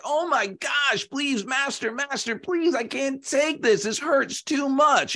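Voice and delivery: high-pitched, frantic begging; pleading clay. Acted. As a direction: beg the master in a high, hammed-up wail. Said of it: "And the clay is like".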